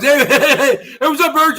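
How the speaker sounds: a light, mocking take on a hyper Lydia; mocking how Lydia talks to people, a hint of manic. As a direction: mimic her manic chirpy greeting. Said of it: "This is how Lydia talks".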